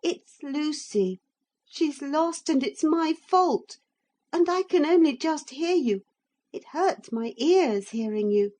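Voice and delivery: put-on high, upset child's voice; a boy fretting about his lost friend; high boyish complaint; complaining boy. A narrator's reading. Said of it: "complained Philip".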